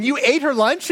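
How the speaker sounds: high-pitched incredulous accusation, retold; mock shocked question. Coworkers walking in on the lunch row, mock shocked.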